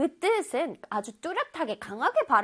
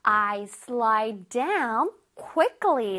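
slow example read; 3 semitones lower, much slower than usual. Shown as a demonstration. Teacher reading example to children, very slow. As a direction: read "I slide down quickly" very slowly. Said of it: "One more time".

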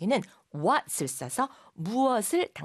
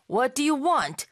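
raised, louder confrontational question; unsmiling demand; 6 semitones higher, 5 dB louder, much slower than usual. Shown as a demonstration. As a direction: ask it without a hint of a smile. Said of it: "방실방실 웃으면서 하는 말 같진 않죠".

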